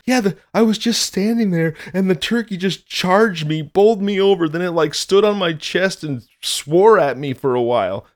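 put-on high, pleading child; little kid voice. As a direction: tattle to mom like a small kid. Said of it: "I was like".

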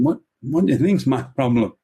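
"What do you think's my problem" with slurred, mangled consonants; mock speech impediment. Man answering the evangelist, "my problem" in a mock speech impediment. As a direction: garble "problem" as if unable to form the sounds. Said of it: "born with this speech impediment".

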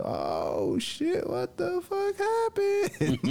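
squeaky, stretched-out mock shock; stunned disbelief. Reacting to her going off on him, squealing in disbelief. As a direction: let it climb into a drawn-out squeal.

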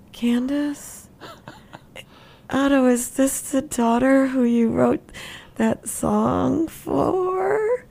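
high, slow, dawning amazement, acted out; goofy, drugged awe. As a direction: stretch it out, imitating a stoned woman's wonder. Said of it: "so I'm goofy".

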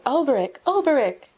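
high, loud cries of joy; joyful cry. A narrator's reading. Boy greeting his long-lost friend, crying out with joy. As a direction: call out his friend's name in delight.

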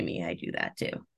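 a secret confession under the breath; whispered aside. Real, not acted. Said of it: "quiet aside".

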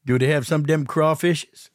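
ordinary delivery

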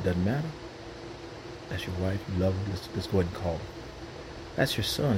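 low, soft, gentle voice of God; voicing God. God gently nudging the believer to pray, low and soft. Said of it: "when God says".

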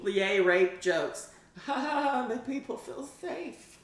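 high, slow fake celebration; drawn-out, high-pitched mock delight; sarcastic mock cheer. Mock cheering own bad joke.

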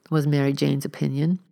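ordinary delivery

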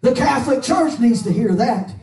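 high, loud fiery preacher's cry; preaching at full force. Acted. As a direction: preach it out hard over the music. Preaching over music.

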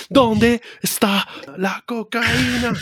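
slow, high mock Mexican accent; hammed-up Mexican movie character, slow and high; Kick-Ass Mexican. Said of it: "Un mexicano ahí como el de Kick-Ass".

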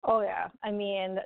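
usual speaking voice

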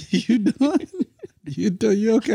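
high teasing check-in. Real, not acted. Husband teasing his tired wife, high and amused. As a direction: tease her gently with a high, amused voice.

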